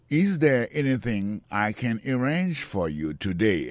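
slow, clear teaching read; slow model sentence. Shown as a demonstration. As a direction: say each word slowly for learners. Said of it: "teacher models the sentence". (li dousman pou elèv yo)

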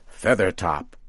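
usual speaking voice

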